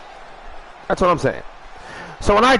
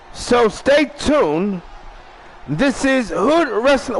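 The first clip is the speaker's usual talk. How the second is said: slow, drawn-out high announcer voice; show announcer outro; 3 semitones higher, slower than usual. Acted. Host closing his wrestling show, slow announcer outro. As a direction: stretch each word like a ring announcer.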